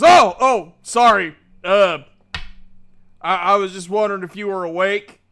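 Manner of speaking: loud, fast startled sorry; flustered apology. Acted. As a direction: caught walking in, blurt it out. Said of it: "He goes".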